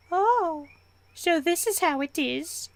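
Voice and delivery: high, slow put-on voice; high-pitched mock offence; mock indignation. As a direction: draw it out in a high, hammed-up huff. Comic reaction to being sent away.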